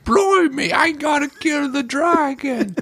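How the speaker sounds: high, slow hammed-up British accent, Blimey drawn out; mock British accent. Mocking the bad British accents in Hollywood films, hammed-up British accent.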